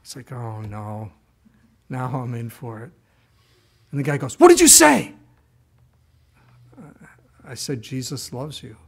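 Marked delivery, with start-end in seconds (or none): 0.3-2.9 quiet dread. Acted. Young man dreading approaching stranger. 4.4-5.1 high, very loud confronting shout; shouted demand. Acted. Stranger shouts after the young man. 7.4-8.8 soft, timid admission; sheepish reply. Acted. Sheepish answer to the shouting man.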